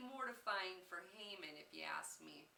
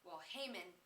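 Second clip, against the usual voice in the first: ordinary delivery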